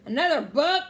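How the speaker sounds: loud, high-pitched disbelieving roar; pirate captain's indignant bellow. A narrator's reading. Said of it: "roared Captain Bones".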